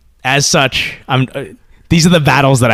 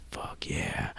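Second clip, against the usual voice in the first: breathy whispered cheer, barely voiced; whispered approval; 7 semitones lower, 22 dB quieter than usual. Dad whispering his delight at his son's brownie pick. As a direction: whisper it like a secret win.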